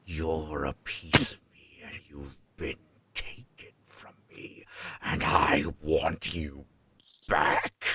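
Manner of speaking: slow, whispered demonic menace; whispering demon, very slow; demon's voice. Retold or imitated. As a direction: draw it out in a low, eerie whisper. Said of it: "And he's like".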